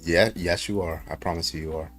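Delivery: ordinary talk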